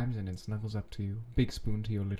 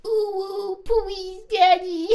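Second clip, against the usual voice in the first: very high cutesy pleading; baby voice begging; 9 dB louder, slower than usual. Pretend. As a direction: beg in a babyish coo.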